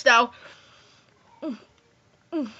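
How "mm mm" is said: ordinary talk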